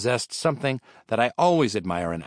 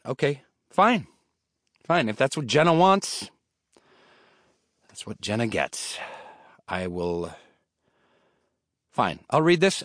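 reluctant reading; 4 semitones higher than usual. Acted. Grudgingly agreeing to read.

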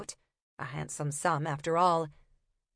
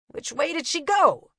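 higher, urgent copper; 9 semitones higher, 4 dB louder than usual. Acted. Copper demanding directions from Una, urgent.

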